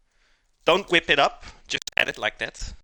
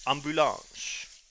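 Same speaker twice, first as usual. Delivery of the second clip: "ambulance" slow with a French accent; 2 semitones higher, 3 dB quieter, slower than usual. Shown as a demonstration. Streamer showing the French word, slow French accent. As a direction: stretch "ambulance" the French way, nasal vowels.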